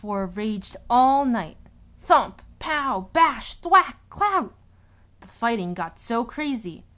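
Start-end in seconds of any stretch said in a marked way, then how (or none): 2.0-4.5 loud, rising fight noises; louder and higher with every crash; punchy sound words. A narrator's reading. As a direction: hit each word like a blow.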